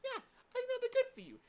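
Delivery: high, loud mock teacher; singsong imitation of a naive teacher; hammed-up encouraging teacher. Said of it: "my fucking teacher was like".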